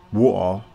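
"Water" said the London way; London-style "Water"; London pronunciation. Shown as a demonstration. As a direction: say "Water" as Londoners do, short and low. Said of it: "that's how we say water in London".

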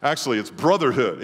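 normal talking voice

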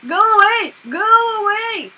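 loud, high yelling; real panicked shout; frightened shout. Real, not acted. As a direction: yell to drive it off. Shouting at the witch.

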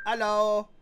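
Hello called loudly into the dark. Acted. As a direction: raise your voice on Hello to be heard. Calls again, louder.

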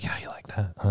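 taunting whisper to an opponent. Acted. Teammate suggests quietly taunting the beaten opponent, whispered. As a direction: whisper it like sly trash talk. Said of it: "whisper them being like".

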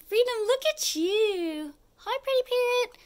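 high sing-song pet talk. Real, not acted. Talking to her parrot.

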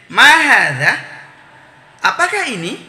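"ma hadza" loud with a rising end, "apakah ini" pitched high; question intonation. Shown as a demonstration. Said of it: "jadi ada intonasinya".

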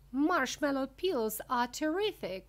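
high, loud and enthusiastic; excited shout. A narrator's reading. Wonka dashing past the marshmallow door, shouting excitedly. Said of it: "shouted Mister Wonka".

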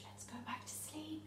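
soft whisper. Real, not acted. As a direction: whisper them back to sleep. Hushing kids back to sleep.

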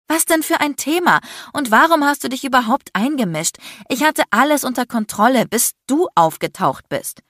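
indignant retort. A narrator's reading. Woman reproaches the man for interfering, indignant. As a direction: push back hard, exasperated. Heated quarrel, woman fires back.

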